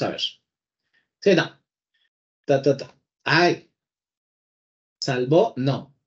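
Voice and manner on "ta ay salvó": normal talking voice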